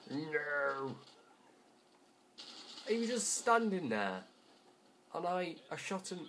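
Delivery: high-pitched whine of disbelief; indignant protest. Real, not acted. Player who shot him but died, indignant. Protests an unfair game death.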